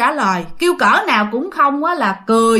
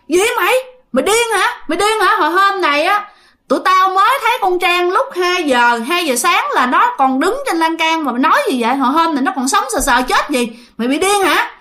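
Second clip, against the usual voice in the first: snapping back; 6 semitones higher than usual. Retold or imitated. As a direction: bark back, shocked and annoyed. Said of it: "mới nạt lại nó nói".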